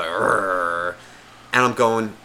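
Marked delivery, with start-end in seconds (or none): 0.0-0.9 low rolling mock growl; imitated dog growl. Narrator copies his dog spotting a bear, growling. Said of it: "starting to do the".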